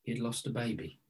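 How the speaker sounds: hushed, low and solemn; grave sorrow. Retold or imitated. Preacher recounting a friend's grief by the fire, hushed sorrow. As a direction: let it drop very soft and low, with weight. Recalls the man's painful losses.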